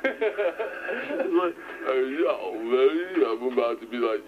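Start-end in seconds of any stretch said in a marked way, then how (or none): 1.9-3.2 lightly put-on slurred action-star voice; Sylvester Stallone. Trying an impression inside an impression, rough Rocky voice. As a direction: mumble it like a boxer. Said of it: "Sylvester Stallone doing an impression of Arnold".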